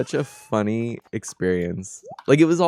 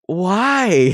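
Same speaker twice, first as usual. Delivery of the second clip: incredulous why; 9 semitones higher, 8 dB louder than usual. Retold or imitated. Retold disbelief at the store.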